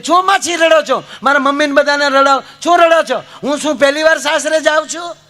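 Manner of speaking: mock-angry bride scolding her relatives. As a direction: snap at the crying family. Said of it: "દીકરી ખીજાણી કે".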